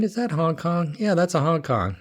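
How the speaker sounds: ordinary talk, nothing marked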